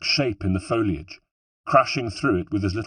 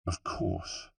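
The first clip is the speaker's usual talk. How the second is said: plain reading voice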